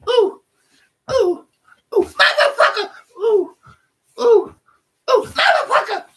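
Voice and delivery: very high falsetto yelps and cursing; imitating aching mother. Imitating mother hurting as she walks, shrieky falsetto cursing. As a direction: hoot and swear like a hammed-up sore old mom. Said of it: "every time she'd walk, she'd go".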